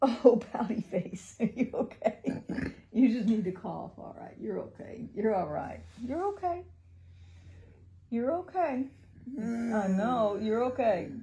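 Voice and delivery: soothing mother. Real, not acted. Mother comforts her coughing son, gently soothing.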